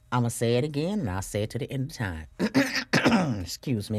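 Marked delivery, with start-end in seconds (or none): 2.3-3.4 throat clearing. Real, not acted. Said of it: "let me clear my throat".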